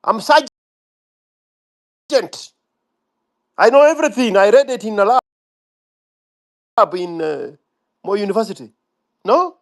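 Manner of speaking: high, cocky parody of a know-it-all; mock the entitled young upstart; mocking Gen Z voice.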